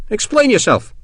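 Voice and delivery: demanding. A narrator's reading. Colonel to his blithering sergeant, demanding. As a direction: order an explanation, pitched high. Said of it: "the Colonel demanded".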